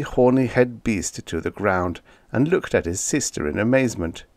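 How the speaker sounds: ordinary delivery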